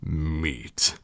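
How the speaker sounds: low, softened single word; quiet low mouse verdict; menacing mouse. A narrator's reading. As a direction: state the demand darkly, low. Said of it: "says the mouse".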